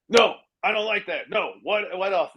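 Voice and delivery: Donald Trump. Retold or imitated. Trump rejecting the writer's next joke, abrupt. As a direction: cut the other person off, imitating a brusque refusal. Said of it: "he was just abrupt".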